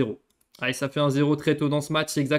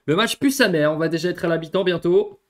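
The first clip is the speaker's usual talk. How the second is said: frustrated; 4 semitones higher, 6 dB louder than usual. Real, not acted. Frustrated complaint.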